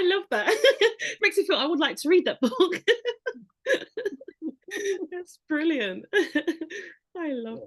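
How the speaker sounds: high, loud words through laughter; laughing. Real, not acted. Host laughing at the guest's misting story. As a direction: talk while cracking up.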